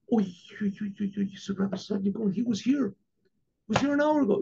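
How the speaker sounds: soft, stuttering start climbing high; stammering shock. Retold or imitated. Innkeeper realizing whom he hosted, acted stammering shock. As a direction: stammer in hushed shock, rising to a high cry.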